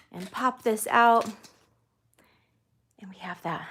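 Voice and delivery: slightly out of breath after running; breathless from the run. Real, not acted. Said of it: "I'm a little out of breath".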